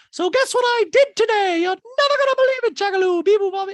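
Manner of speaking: put-on very high, loud cooing baby talk; Dorit's baby talk. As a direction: coo it to a toddler. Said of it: "And Dorit's like".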